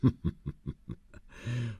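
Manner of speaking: a high, amused villain's chuckle; a knowing boss's laugh at the new recruit; sinister chuckle. Acted. Boss amused by employee's calm.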